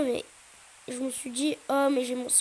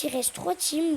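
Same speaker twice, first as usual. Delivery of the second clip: ordinary delivery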